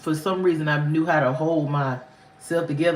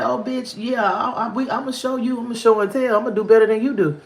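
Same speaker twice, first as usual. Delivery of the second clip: defiant retort; 5 semitones higher than usual. Retold or imitated. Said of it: "I said".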